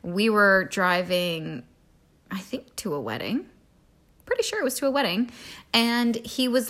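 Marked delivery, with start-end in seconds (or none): 2.3-3.5 whispering. Real, not acted. Whispered aside while telling story.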